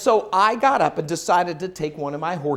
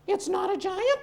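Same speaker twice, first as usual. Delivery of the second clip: puzzled horse question; 10 semitones higher, 5 dB quieter than usual. Acted.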